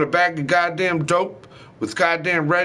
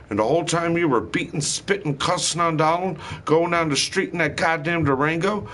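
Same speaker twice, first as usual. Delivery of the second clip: ordinary talk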